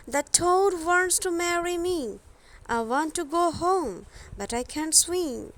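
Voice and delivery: put-on a touch of a worried small girl; Thumbelina's voice. Thumbelina telling the fish her trouble, in a light girlish voice.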